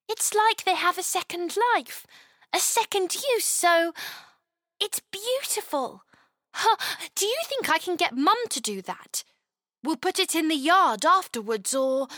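put-on high, delighted little girl; a child full of wonder; amazed young girl. Chloe delighted.

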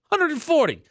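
can you believe it; incredulous. Real, not acted. Repeating the scooter count on air, incredulous.

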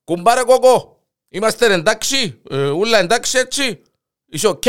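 loud, high call to the neighbour; calling through the wall. Acted. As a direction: shout the question next door. (φωνάζει από τον τοίχο)

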